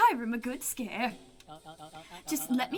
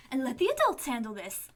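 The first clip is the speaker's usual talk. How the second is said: ordinary talk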